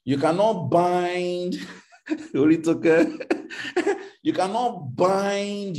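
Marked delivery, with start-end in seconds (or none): 2.1-5.8 laughing through the words. Real, not acted. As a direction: chuckle as you repeat it.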